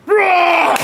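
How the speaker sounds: loud, high imitated monster roar. Monster springing out at the end of a haunted house, mock roar. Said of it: "have a monster come out and go".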